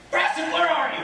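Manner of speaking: high, impatient call; calling for a servant. Acted. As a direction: call out for the missing butler. Calls for his missing servant.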